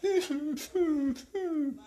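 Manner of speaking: mock high-pitched squealing laugh; sissy squeal. Shown as a demonstration. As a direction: squeal it like a scared little sissy. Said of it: "you can sound like a little sissy".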